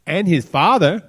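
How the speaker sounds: high, loud trashy talk show guest; proud trashy guest. Retold or imitated. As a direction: brag like a shameless show guest. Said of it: "they go".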